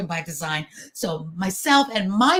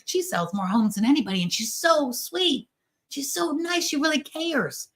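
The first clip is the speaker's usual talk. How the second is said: admiring gossip; 5 semitones higher than usual. Retold or imitated. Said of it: "it's like".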